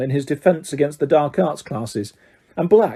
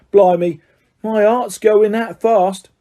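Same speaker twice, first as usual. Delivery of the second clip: higher, slow, still rattled conductor; shaken Stan; 6 semitones higher, 4 dB louder, slower than usual. Acted. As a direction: catch your breath after a fright. Stan shaken after the name.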